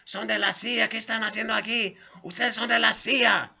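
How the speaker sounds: high, loud woman yelling in Spanish; shrieking Spanish accusation. Retold or imitated. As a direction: scream the accusation down from the balcony. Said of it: "She said".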